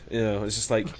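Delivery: ordinary talk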